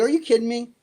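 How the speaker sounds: imitated incredulous question; incredulous retort. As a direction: retell it as a disbelieving comeback. Retold disbelief at agents' demand.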